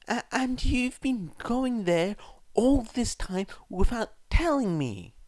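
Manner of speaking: high, stammering, tearful mother's voice; choked sobbing. A narrator's reading. Mother hurt her son hid it, choking through sobs. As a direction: choke the words out through tears. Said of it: "Inko choked out through her sobbing".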